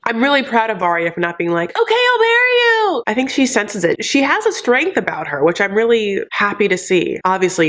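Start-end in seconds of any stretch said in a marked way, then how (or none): 1.8-3.0 squeaky high mock-sweet yes; much higher, hammed-up eager agreement; mock giving in. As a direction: parody a girl caving instantly. Mocking Varya giving in.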